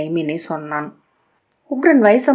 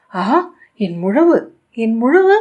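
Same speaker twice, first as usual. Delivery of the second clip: rising, louder excited cry; 5 semitones higher, 5 dB louder than usual. A narrator's reading. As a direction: cry out with glee for the drum. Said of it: "கூவினான்".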